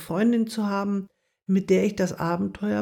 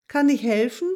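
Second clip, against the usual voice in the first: calling out; 5 semitones higher, 4 dB louder than usual. A narrator's reading. Mouse offering help to a hidden voice, calling out. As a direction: friendly call toward the hedge. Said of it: "rief sie".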